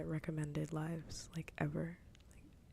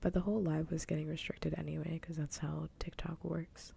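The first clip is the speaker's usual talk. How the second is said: hushed whisper all the way through. Real, not acted. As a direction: keep it a secret-sharing whisper.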